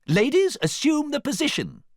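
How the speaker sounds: loud, very high command. A narrator's reading. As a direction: cry out an order in a high voice.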